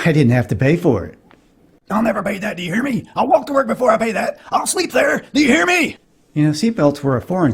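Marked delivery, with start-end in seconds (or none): 1.9-6.0 high-pitched comic indignant rant; ranting dad. Retold or imitated. Dad refuses to pay gas price, ranting dad.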